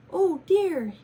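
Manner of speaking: high, loud duck cry; startled duck voice; quacking duck. Acted. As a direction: quack it out in alarm. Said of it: "he quacked".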